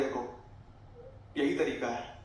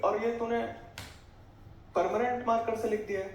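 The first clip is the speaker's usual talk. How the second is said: higher mock mother's scolding; imitating mom scolding; 7 semitones higher than usual. Mother scolding son over marker on wall, higher mock scold. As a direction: play mom fretting about the ruined wall.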